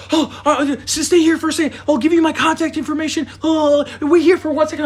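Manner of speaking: flustered owner. Retold or imitated. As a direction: plead like the nervous owner. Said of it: "she's like".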